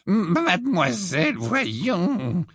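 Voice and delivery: put-on stammering, shocked old-teacher reproach; scandalized professor. A narrator's reading. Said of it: "dit le professeur Tufty scandalisé".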